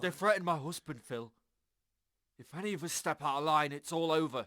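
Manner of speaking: frightened outburst. Acted. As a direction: let the fear spill out loud. Frightened outburst about the threat.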